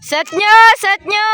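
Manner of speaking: loud, high call to players; urgent shout. Real, not acted. Spectator urging players to set, high loud shout. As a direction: yell it out high across the court.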